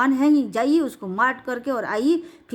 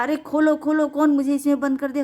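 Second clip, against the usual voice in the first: trapped lion roaring; 6 semitones higher than usual. Acted. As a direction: shout for help from inside a sealed cave. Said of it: "अंदर से दहाड़ा".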